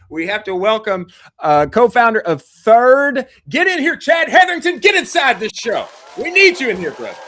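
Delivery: shouting the guest onto the show; calling the guest in. Acted. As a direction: hype up the guest's entrance like a ring announcer. Introducing guest, calling him in.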